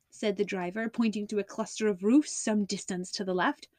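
plain reading voice

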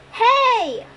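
sudden high shout to draw attention; a sharp, high "Hey!" across the bus; shouted call. A narrator's reading. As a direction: yell it to make them turn. Percy calls out to the Furies.

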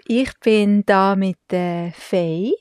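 normal talking voice

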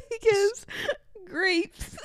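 high, crying with laughter; laughing to tears. Real, not acted. Host describing husband's eyes, tearful laughter. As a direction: force the words out through helpless laughter.

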